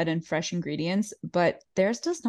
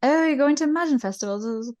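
mock posh surprise; 7 semitones higher, 4 dB louder than usual. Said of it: "she's like".

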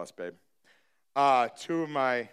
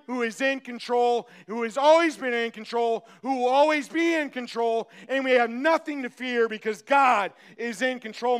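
loud, high preacher's voice; rising sermon cry; full-force preaching; 10 semitones higher, 6 dB louder than usual. Acted. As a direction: preach it with fire to the congregation. Preaching builds.

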